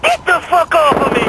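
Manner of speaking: high-pitched yell under attack; struggling shout. Acted. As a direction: shout while fighting someone off. Fighting off attackers over radio.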